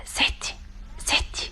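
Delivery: hushed whisper calling grandma; a soft breathy call of ستي, barely voiced. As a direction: whisper so as not to startle her. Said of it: "صوتك مبحوح كده ليه؟".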